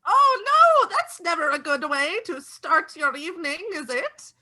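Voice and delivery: put-on high, sweet old neighbour; pry politely like a kindly old neighbour; old neighbour quizzing the vampires, high and sweet; old lady voice.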